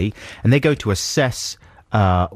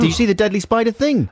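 excited; 13 semitones higher, faster than usual. Real, not acted. Excited new topic.

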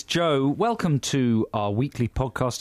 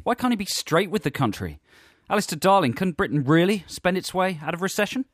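high, punchy talk-radio intro; mock phone-in host; 8 semitones higher than usual. Host opening a spoof political phone-in, mock presenter voice. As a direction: sell it like a hard-hitting political phone-in presenter.

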